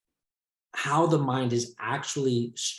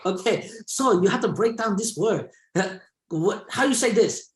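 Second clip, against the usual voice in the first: put-on high Chinese-accented teacher explaining; Chinese-accented English teacher coaching a student; teacher imitation; 8 semitones higher than usual. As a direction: play the teacher breaking the word down. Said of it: "And he's like".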